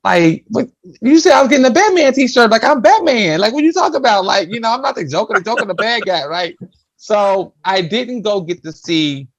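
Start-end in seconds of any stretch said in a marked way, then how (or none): 0.1-6.6 put-on high kid voice protesting; a kid insisting he's Batman, high and whiny; childish protest. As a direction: plead like an indignant little boy. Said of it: "And I'm like".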